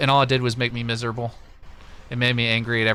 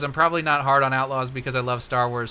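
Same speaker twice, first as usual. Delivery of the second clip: normal talking voice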